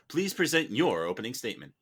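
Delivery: usual speaking voice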